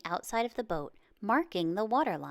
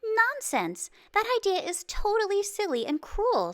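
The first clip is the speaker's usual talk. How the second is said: much higher and louder, indignant scold; 13 semitones higher, 5 dB louder than usual. A narrator's reading. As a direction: snap at the cruel idea in outrage. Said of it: "someone scolded".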